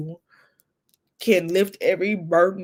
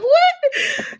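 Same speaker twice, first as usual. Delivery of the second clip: very high, laughing "What?"; high, laughing disbelief; shocked what; 6 dB louder than usual. Retold or imitated. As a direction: squeal it in disbelief. Teen self shocked.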